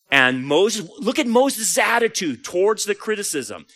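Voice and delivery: usual speaking voice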